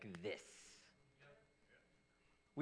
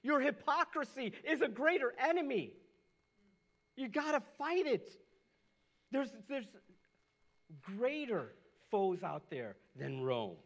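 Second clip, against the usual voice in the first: higher and louder, pleading with the guys; urgent appeal; 8 semitones higher, 6 dB louder than usual. Retold or imitated. Pastor voicing Jesus warning the crowd, loud and urgent. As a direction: press the warning hard, almost shouting. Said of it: "He's pointing out to them".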